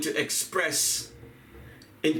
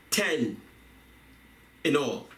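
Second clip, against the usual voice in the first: normal talking voice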